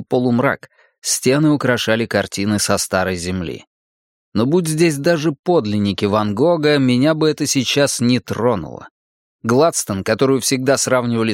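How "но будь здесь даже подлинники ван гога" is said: ordinary delivery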